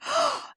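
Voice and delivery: high surprised gasp of recognition; delighted gasp. Retold or imitated. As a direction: gasp as if spotting an old friend. Officials greet a familiar visitor.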